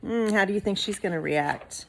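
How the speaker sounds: usual speaking voice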